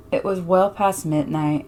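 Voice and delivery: ordinary delivery, nothing marked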